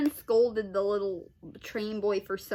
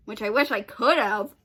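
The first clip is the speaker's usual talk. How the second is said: ordinary delivery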